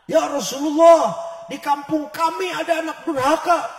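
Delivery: loud villager complaint. Retold or imitated. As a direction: call out to the Prophet in alarm. Villagers complain loudly to Prophet.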